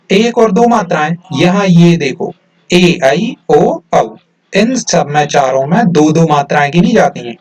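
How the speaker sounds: usual speaking voice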